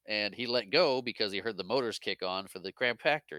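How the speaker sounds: ordinary talk, nothing marked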